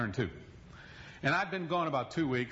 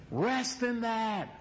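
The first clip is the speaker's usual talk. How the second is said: slow reassurance; 5 semitones higher, much slower than usual. Real, not acted. Preaching close.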